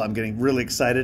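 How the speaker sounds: ordinary talk, nothing marked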